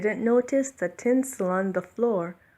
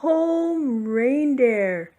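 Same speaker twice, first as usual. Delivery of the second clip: higher, louder call; 6 semitones higher, 7 dB louder, much slower than usual. A narrator's reading. Santa calling his reindeer home, loud call. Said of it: "and called".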